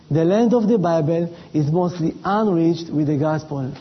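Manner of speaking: ordinary delivery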